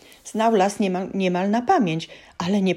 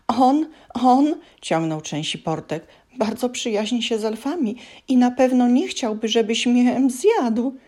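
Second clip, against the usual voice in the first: timid elf voice; 7 semitones higher, 4 dB louder than usual. A narrator's reading. Elf pleads.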